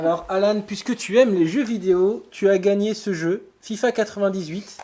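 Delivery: upbeat, high host announcement; game show host. Acted. Presenting a prize to Alan.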